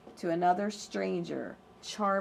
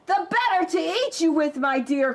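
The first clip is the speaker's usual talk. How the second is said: loud, higher triumphant wolf; wolf cries out; 7 semitones higher, 6 dB louder than usual. Acted. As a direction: drop the disguise and pounce. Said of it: "cried the wolf".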